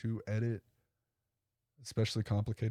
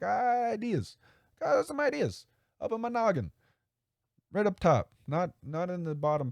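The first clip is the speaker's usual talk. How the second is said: much higher silly cartoon voice; squeaky jokey voice, tapping his head; playful high-pitched bit about his noggin; goofy high voice; 10 semitones higher than usual.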